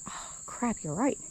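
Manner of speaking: hushed "oh crap, you're right"; whispered realisation. Retold or imitated. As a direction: breathe it out, suddenly dawning.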